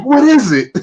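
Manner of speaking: high, exasperated imitation of snapping back; mock yell. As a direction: act like you're yelling back in annoyance. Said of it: "Paper yell at you".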